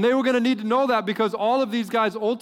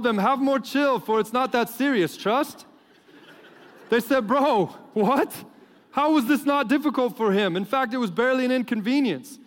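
higher, hammed-up comic storybook read with laughs breaking through; Gen Z story reading; 5 semitones higher than usual. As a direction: read it like a joke Bible for teens. Reads Gen Z Bible paraphrase.

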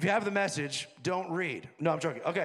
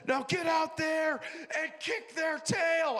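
higher, slow rallying shout at the team; coach's halftime yell; 7 semitones higher, slower than usual. Retold or imitated. Coach rallying his losing team at halftime, slow high shout.

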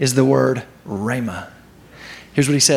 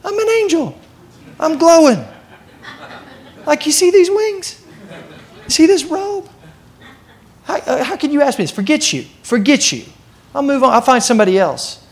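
high-pitched, hammed-up offended angel; indignant angel; 9 semitones higher than usual. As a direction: squeak with mock outrage at being questioned. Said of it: "the angel doesn't go".

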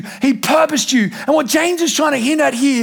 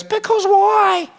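put-on high, slow whiny child asking why; toddler voice; 9 semitones higher, much slower than usual. Dad imitating his son's whiny question. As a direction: mimic a small boy's drawn-out question. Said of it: "he looks at me and goes".

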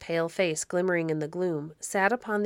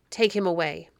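plain reading voice

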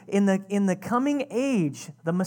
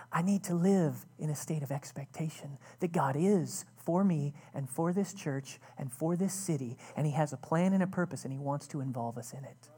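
hushed confession; 4 semitones lower, 5 dB quieter than usual. Real, not acted. Pastor confessing fear to the church, in a hushed near whisper.

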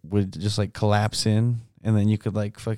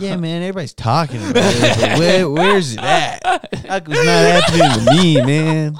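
high, loud dopey hippie whine; stoner hippie voice; 7 semitones higher, 8 dB louder than usual. Retold or imitated. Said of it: "we would just be like".

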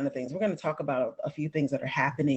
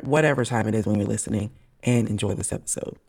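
ordinary delivery

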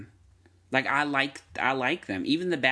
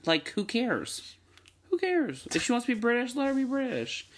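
much higher, incredulous who cares; exasperated; 12 semitones higher than usual. Real, not acted. Speaker rants to co-host about accents, exasperated. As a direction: throw your hands up in disbelief.

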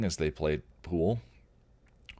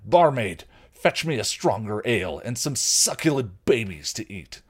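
loud commanding troll voice; ordering troll; 3 semitones higher, 4 dB louder than usual. Acted. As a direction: demand it like a greedy tyrant. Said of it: "the troll ordered".

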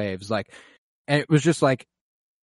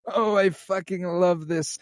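gleeful excitement; 8 semitones higher, slower than usual. Retold or imitated. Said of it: "it's like".

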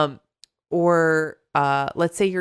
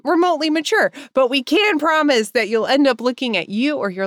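louder, lifted host announcement; big, animated welcome read; podcast intro; 6 semitones higher, 5 dB louder than usual. Acted. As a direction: sell the show's opening with punch. Reads show intro.

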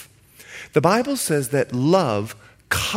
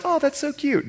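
much higher, cooing mom voice; imitating doting moms; 11 semitones higher than usual. Preacher mimicking moms charmed by the boy, high and cooing. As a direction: gush like a doting mother. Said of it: "The moms are like".

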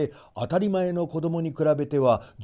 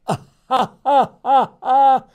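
loud, high ha ha ha ha; old couple laughing at their baby; hearty laugh; 11 semitones higher, 10 dB louder than usual. A narrator's reading. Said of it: "と面白そうに笑いました".

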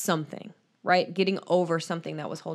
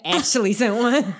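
louder, higher mimicry of a co-host; mocking echo; 5 semitones higher, 5 dB louder than usual. Co-host teases the host about the letter plea, mocking echo. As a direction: parrot it back in mock emphasis. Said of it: "Don't say it like that".

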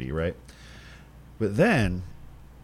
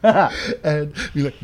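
laughing; 6 semitones higher, 7 dB louder, much slower than usual. Real, not acted. Laughing before the meme quote.

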